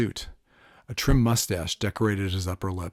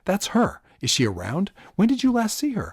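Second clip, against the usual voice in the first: higher eager questions; excited; 11 semitones higher, 4 dB louder than usual. A narrator's reading. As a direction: press him eagerly for news. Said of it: "I said excitedly".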